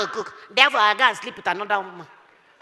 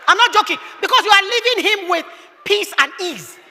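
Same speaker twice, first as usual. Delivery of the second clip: emphatic, high; 8 semitones higher than usual. Acted.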